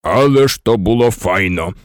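low, rumbling boom; booming giant's voice. A narrator's reading. Hagrid over the heads of the Gryffindors, booming. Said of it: "гудів над головами грифіндорців Гегрід".

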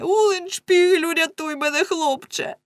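high cry for help; desperate call. A narrator's reading. Lamme calling his friend to save him, desperate high cry.

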